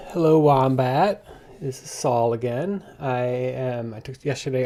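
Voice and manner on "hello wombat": slow, drawn-out hello to the dog; pet greeting. Real, not acted.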